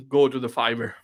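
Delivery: ordinary delivery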